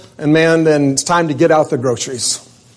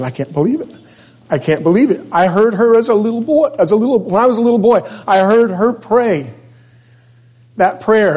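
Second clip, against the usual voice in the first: moved amazement; 8 semitones higher than usual. Retold or imitated. Old man overwhelmed recounting prayer.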